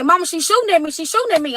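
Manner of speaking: panicked son. Retold or imitated. Mother retells son yelling on phone during gunfire, frightened. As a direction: voice the son's alarm as shots ring out.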